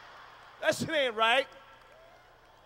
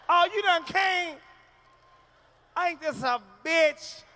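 slow, loud exasperated wife's complaint; disappointed wife; 6 semitones higher, 6 dB louder, slower than usual. Retold or imitated. Wife complaining that her husband finished already, slow and loud. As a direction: drag it out, fed-up. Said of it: "wife after he came".

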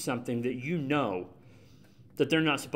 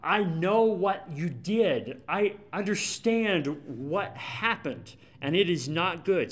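frustrated; 4 semitones higher, slower than usual. Retold or imitated. Samuel cutting off Saul's excuses, exasperated. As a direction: cut him off, fed up and slow. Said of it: "Samuel gets so frustrated here".

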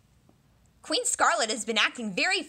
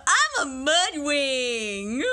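dopey voice; much slower than usual. Acted. Dopey voice after "Duh.

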